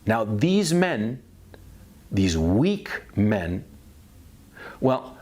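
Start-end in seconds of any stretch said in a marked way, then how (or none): none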